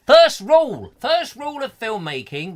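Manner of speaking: high, loud proclamation; emphatic lecturing. Pretend. Host scolding a listener over bad sound, loud lecturing. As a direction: call out the rule like an exasperated teacher.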